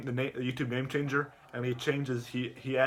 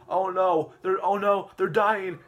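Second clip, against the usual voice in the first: mock horror; 6 semitones higher, 6 dB louder, slower than usual. Acted mock horror for eggs.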